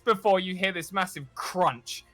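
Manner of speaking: game master painting a chase scene; dramatic scene narration. Acted. Game master setting the scene for players, cinematic narration. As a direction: narrate it like a movie trailer.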